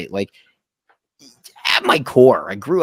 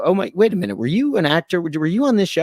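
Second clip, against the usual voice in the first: higher, politely curious fan voice; 7 semitones higher than usual. Retold or imitated. Bar guest politely asking the bartender if he acted, higher and tentative. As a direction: ask it like a tentative, respectful fan. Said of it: "a way to be respectful about it".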